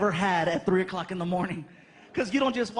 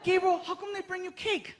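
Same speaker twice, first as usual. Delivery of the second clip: put-on kid's question; 9 semitones higher than usual. Frankie seeing the cakes, high excited kid voice. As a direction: ask like an amazed little boy.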